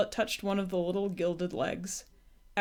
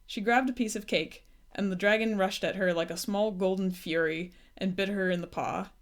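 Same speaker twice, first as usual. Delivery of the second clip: plain reading voice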